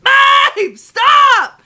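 high, loud shriek, half laughing; shocked screaming. Retold or imitated. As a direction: shriek at him in disbelief. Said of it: "I said".

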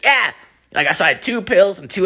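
one high, eager yelp of agreement; mock over-caffeinated reply; imitating wired friend. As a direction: blurt it like a buzzing buddy. Wired friend's unbothered answer.

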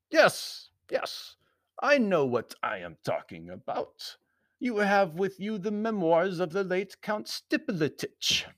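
Baron's voice, rising high; accusing foreign Baron. A narrator's reading. Baron accusing Anthony over the memoirs, stern voice. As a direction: press the accusation as the formal Baron.

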